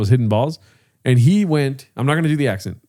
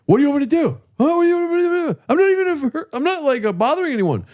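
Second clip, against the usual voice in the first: high, whiny mock complaint; whiny golfer; faster than usual. Retelling the filming golfer's protest, high whiny voice. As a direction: mimic the annoyed golfer in a high voice.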